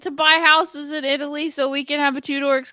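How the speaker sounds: loud, high excited shout of Jacob; calling out Jacob in delight. Real, not acted. Excited call to buy houses.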